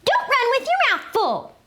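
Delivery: high, fast playful mom scold; scolding mom holler. Acted. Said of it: "hollered Mama".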